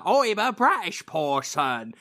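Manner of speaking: hammed-up, drawn-out fake British accent; mock an American badly faking British, slowly. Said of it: "American does a British accent voice".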